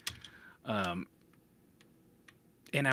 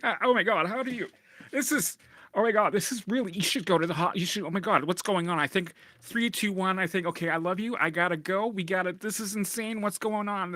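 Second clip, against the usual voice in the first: higher, rushed, mock-panicked babble; 6 semitones higher than usual. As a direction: act out someone losing their mind in terror. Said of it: "They'd be like".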